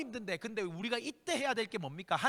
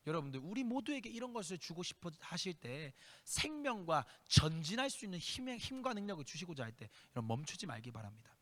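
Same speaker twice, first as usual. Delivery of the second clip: ordinary talk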